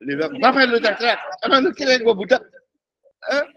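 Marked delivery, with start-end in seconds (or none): 0.4-2.4 acted indignant telling-off; snapped-back rebuke. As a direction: bark back at a noisy supplicant. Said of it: "lu dibentak balik lu".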